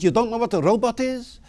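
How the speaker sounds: put-on high-pitched, incredulous South African accent; South African accent, disbelieving question. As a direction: imitate an astonished South African driver. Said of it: "says in a lovely South African accent".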